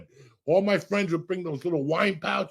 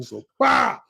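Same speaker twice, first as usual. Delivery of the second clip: firecracker bang; 10 semitones higher, 4 dB louder than usual. Retold or imitated. Imitating a firecracker going off.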